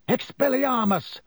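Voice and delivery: high-pitched yell, no great volume; yelled spell. A narrator's reading. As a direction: cry out the spell, pitched well up. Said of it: "Harry yelled".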